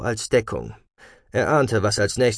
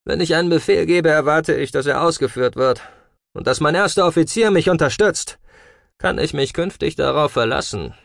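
stern, raised, brisk reprimand; stern commander; 6 semitones higher, 3 dB louder than usual. A narrator's reading. Commander demands obedience from his officer Teller, stern. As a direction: lay down the law, high and forceful. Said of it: "Streng wandte der Kommandant sich an Teller".

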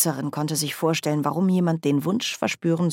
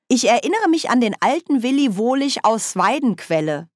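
voz más aguda y vivaz de bruja parlanchina; Nanny, animada; 7 semitones higher, 4 dB louder than usual. Acted. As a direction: recuerda con entusiasmo, tono agudo.

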